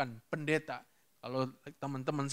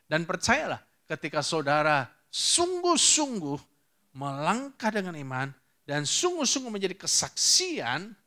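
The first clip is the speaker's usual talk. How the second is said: loud, emphatic exhortation; urging the congregation on, loudly; forceful preaching; 4 semitones higher, 7 dB louder than usual. Real, not acted. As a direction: preach it with weight on each phrase.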